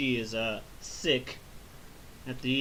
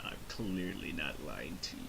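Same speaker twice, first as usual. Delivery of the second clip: sinister Paragus; 11 semitones lower, 12 dB quieter than usual. Retold or imitated. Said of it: "sinister chuckle and laugh".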